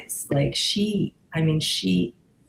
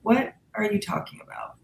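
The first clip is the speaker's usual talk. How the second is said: ordinary delivery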